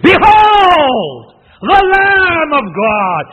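huge, high-pitched shout with long drawn-out words; very emphatic proclamation. Acted. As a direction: cry it out at full force to a crowd. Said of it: "No, it's very emphatic".

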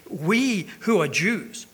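slow, drawn-out sarcastic jab. Retold or imitated. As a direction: lean on it with mock pride. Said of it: "He's being a little bit sarcastic here".

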